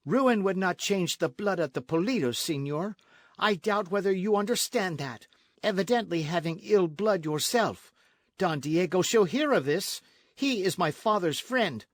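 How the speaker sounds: proud, high and forceful; indignant retort. A narrator's reading. Señorita answers captain's insult to her family, indignant. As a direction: defend your blood with heat.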